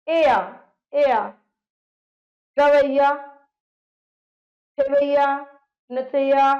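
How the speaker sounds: teacher calling out words ending in ऐया; loud emphatic word list. Shown as a demonstration. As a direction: recite the examples loudly.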